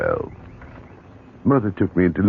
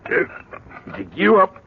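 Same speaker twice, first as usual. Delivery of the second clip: excited; 3 dB louder, slower than usual. Acted. Excited at finding buried stone.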